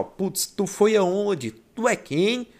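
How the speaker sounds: put-on high, lilting Northeastern Brazilian accent; Pernambuco accent. Teacher imitating someone from Pernambuco, high Northeastern accent. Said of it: "Você vai falar assim".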